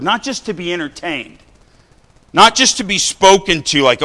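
rising sermon fervour; fervent preaching. Acted. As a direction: preach it out to the pews. Preaching to congregation.

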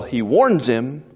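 usual speaking voice

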